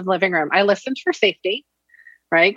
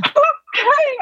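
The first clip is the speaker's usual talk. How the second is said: put-on very high, a little boy giving in; a squeaky child's okay; kid voice okay; 3 dB louder than usual. Mother imitating her son agreeing to go sit down, high kid voice.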